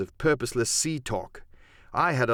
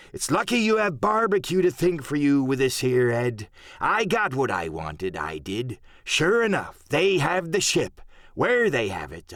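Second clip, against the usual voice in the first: put-on rally your crew as a cunning old sea dog; scheming pirate cook.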